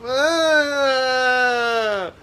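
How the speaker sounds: high mock whine; pointing and whining for the mug; whiny whimper. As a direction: whine like a needy child. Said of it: "point at it and whine".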